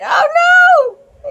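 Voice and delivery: high, loud oh no; alarmed cry. Real, not acted. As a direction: yelp in alarm at the page. Alarm at whistle blown.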